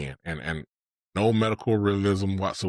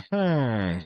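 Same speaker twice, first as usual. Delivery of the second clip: long falling villager "hmm"; imitated villager grunt-hum; 3 semitones higher than usual. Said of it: "villagers speak in a".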